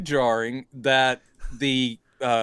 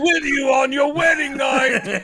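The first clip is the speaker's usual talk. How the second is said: high, slow, shouted tease; hammed-up cheering cop; 10 semitones higher, 4 dB louder than usual. Cops teasing Victor about his wedding night, hammed-up shout. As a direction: call it out in a put-on voice. Cops mimic monster's threat, leering.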